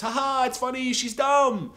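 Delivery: high, loud mock laughter; mocking fake glee. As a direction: parody someone finding a cheap joke hilarious. Mimics show laughing at Brittany.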